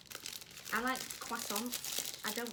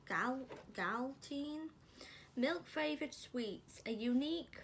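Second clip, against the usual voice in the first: reading a label; 3 semitones higher than usual. Acted. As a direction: read the label like an advert. Reads the product description aloud.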